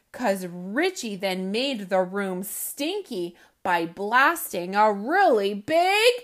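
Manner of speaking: put-on animated children's book reading, loud punchlines; lively read-aloud. A narrator's reading. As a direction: read it with big comic energy for kids. Reads picture book aloud.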